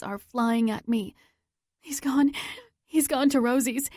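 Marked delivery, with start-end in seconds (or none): 1.8-3.9 gasping out the news between breaths; breathless panting. Acted. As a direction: speak while out of breath, urgent. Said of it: "I pant".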